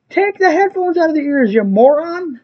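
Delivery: high-pitched indignant order; exasperated yell. Retold or imitated. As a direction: tell off an oblivious customer, voice way up. Yelling back at headphone wearer.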